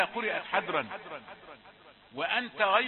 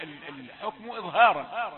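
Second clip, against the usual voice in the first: ordinary talk, nothing marked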